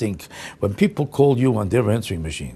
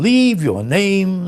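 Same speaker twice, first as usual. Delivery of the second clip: very slow, higher, talking down to a moron; condescending instruction; 6 semitones higher, much slower than usual. Retold or imitated. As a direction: spell it out like to an idiot. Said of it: "Everybody tells you the same thing".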